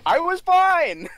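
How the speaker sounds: high, loud, drawn-out objection; indignant protest. Acted. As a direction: protest loudly that it's not your fault. Character protests innocence.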